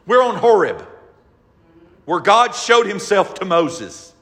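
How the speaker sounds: loud, rising pulpit preaching; full-force preaching, high and loud. Acted. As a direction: drive it home to the congregation. Preacher building intensity.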